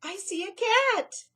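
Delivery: high, loud alarm. Acted. Mouse spotting a cat, alarmed. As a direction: warn of the cat.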